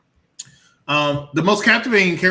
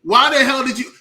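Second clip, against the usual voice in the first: exasperated outburst; 5 semitones higher, 7 dB louder than usual. Real, not acted. Exasperated rant about dumb character.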